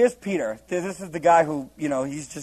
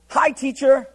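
breezy everyday hello, pitched higher; casual hi; 8 semitones higher, 4 dB louder, slower than usual. Shown as a demonstration. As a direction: say it like greeting a friend. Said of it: "It means hi".

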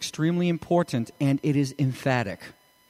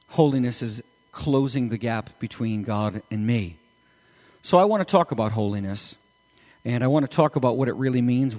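ordinary delivery

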